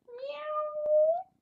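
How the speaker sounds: high squeaky meow; cat meow. Pretend. Reading to toddler, high mock kitten meow. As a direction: mew like a kitten. Cat sound in picture book.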